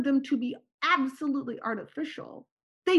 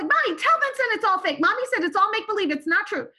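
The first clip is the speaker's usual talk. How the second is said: put-on excited child pleading; 7 semitones higher, 3 dB louder than usual. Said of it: "I run into the kitchen and I'm like".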